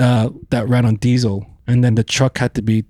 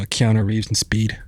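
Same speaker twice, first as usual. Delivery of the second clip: normal talking voice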